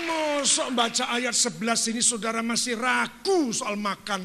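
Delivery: loud, high sermon cry; preaching at full force. Real, not acted. Preacher rousing the congregation, shouting. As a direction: shout it to the whole church.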